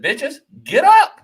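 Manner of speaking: high, slow and loud order; slow high yelled command to get up; retold bark. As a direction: shove them off the couch with your voice. Ordering girls off the couch.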